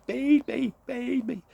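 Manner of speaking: very high, loud chick cries; shrill little chicks crying for food; baby birds begging. A narrator's reading. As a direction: squeak it out like hungry baby birds. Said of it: "they were still saying".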